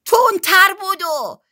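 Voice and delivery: high, loud, slow stretched-out shout; urging yell. A narrator's reading. Henry urges Betty on during the game, drawn-out yell. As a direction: yell it to spur a runner on. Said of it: "هنری داد زد".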